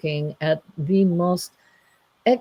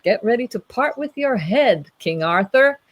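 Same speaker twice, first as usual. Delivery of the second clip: raised, menacing challenge, easing off; angry knight; 7 semitones higher, 4 dB louder than usual. Acted. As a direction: threaten like a storybook knight. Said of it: "the angry knight is there".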